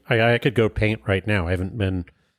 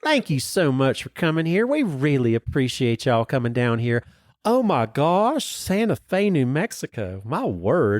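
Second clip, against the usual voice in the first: put-on Southern accent; 3 semitones higher than usual. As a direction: put on a sugary Southern welcome. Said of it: "I'm hearing South in your mouth over there".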